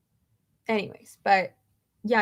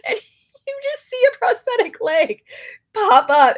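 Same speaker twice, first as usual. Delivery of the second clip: laughing hard; 13 semitones higher, 9 dB louder than usual. Real, not acted. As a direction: voice breaking into high squeals of laughter. Cracking up telling the story.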